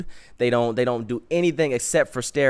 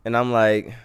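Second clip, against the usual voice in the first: usual speaking voice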